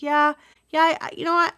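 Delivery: bursting into agreement as it clicks; excited realization. Retold or imitated. Retelling her meditation breakthrough, eager and excited.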